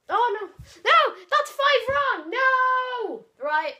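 high, loud despairing yell; dismayed shout. Real, not acted. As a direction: wail at losing. Losing on five wrong.

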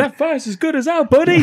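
high, loud cheesy bystander; hammed-up cheer. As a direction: cheer it like a bad extra. Mocking overdubbed crowd lines.

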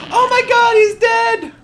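game pet just died; shocked shout. Real, not acted. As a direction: cry out in alarm.

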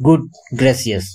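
ordinary delivery

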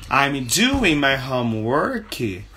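slow, even example sentence; slow teaching model. Shown as a demonstration. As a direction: model it for repetition.

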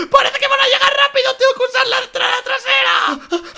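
high, loud, frantic yelling; panicked rush. Acted. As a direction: blurt it out while racing against time. Corre para llegar antes que ellos. (grito apurado y con pánico)

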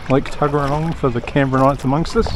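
ordinary talk

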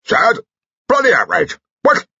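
high, loud blustering bark; outraged general. Acted. General erupts at his wife over the news, outraged. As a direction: explode in short bursts of outrage.

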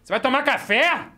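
pointed warning question; mom's warning tone. Retold or imitated. Mom warning son at the table, pointed tone. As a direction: ask it like a mother daring the child. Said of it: "com um tom que só a mãe sabe".

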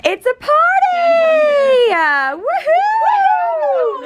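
high, slow, gleeful party yell; excited shout. Real, not acted. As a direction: cheer it out loud and high.

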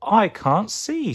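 high, slow plaintive wail; acted high whimper; mock sobbing. A narrator's reading. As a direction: wail helplessly, stretched out. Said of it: "sobbed Tomato".

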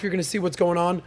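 ordinary delivery

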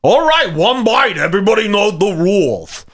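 mocking, high-pitched New York accent; hammed-up New York food reviewer voice; Dave Portnoy impression. Reviewer announcing one bite to viewers, exaggerated New York accent. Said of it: "Dave Portnoy".

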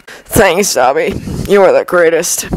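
worn-out rasp; weary, rasping character voice; raspy. A narrator's reading. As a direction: rasp out thanks through a pounding headache. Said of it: "rasped Harry".

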